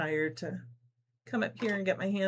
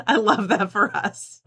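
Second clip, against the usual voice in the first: louder, laughing delight; 3 semitones higher, 7 dB louder than usual. Real, not acted. Host delighted by a viewer's comment, laughing loudly. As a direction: laugh it out, tickled.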